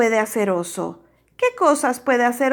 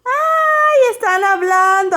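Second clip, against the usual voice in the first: high, loud gasp of delight; delighted exclamation; 9 semitones higher, 5 dB louder than usual. Real, not acted. Teacher excited telling children friends are talking.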